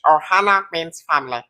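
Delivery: raspy cartoon alien voice; Stitch voice. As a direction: squeak it like Stitch. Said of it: "you sound like Stitch".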